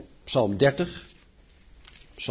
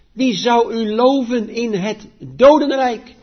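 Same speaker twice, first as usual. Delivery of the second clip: solemn, raised psalm reading, slowing on the pleas; scripture reading; 7 semitones higher, 5 dB louder than usual. Acted. Preacher reads the psalm aloud to the congregation, solemn reading. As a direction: read it like a preacher lifting the psalm up.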